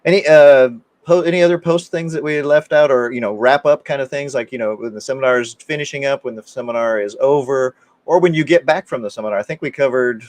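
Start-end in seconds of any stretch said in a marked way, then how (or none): none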